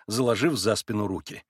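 plain reading voice

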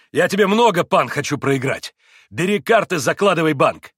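loud, high, boisterous; eager urging. A narrator's reading. Mitya pushes the Pole to deal, loud and eager. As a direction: press him to start at once.

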